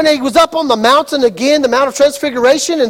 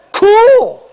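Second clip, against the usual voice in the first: high, louder breezy cool; laid-back cool; 8 semitones higher, 4 dB louder than usual. Retold or imitated. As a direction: shrug it off, unimpressed. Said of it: "they went".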